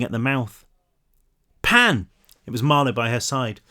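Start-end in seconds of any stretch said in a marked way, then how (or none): 1.6-2.1 high, urgent shout of a name; urgent call. Acted. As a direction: call out sharply to stop someone. Marlowe intervenes as Pan shocks girl.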